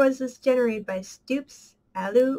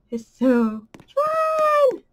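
high, slow, loud squeal; excited squeal; 14 semitones higher, 6 dB louder, much slower than usual. Real, not acted. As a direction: squeal it out with joy. Squeal of joy in game.

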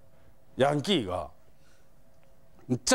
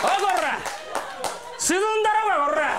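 yankee yelling; 14 semitones higher than usual. Retold or imitated. Delinquent threatening the clerk, furious shout. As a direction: bellow it like a furious thug. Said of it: "気づかずずっとそれを言うてはる".

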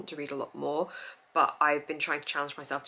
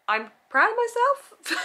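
laughing; 8 dB louder, slower than usual. Real, not acted. Proud laugh at own stats.

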